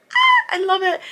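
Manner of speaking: high, loud fangirl squeal; a high, loud burst of joy; excited squeal. Real, not acted. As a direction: squeal with delight over the scene. Gushing over the old four together.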